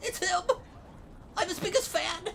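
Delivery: high nasal gushing fan; nasally voice. Pretend. Role-playing a biggest fan, high nasally voice. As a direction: squeal it like a starstruck superfan, through the nose. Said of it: "I can't do the nasally voice".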